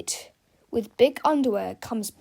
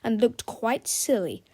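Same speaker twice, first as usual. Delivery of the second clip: ordinary delivery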